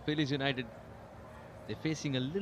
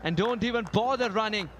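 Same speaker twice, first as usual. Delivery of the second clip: excited commentary; 6 semitones higher than usual. Real, not acted. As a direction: rise with the ball off the bat. Calling a big shot.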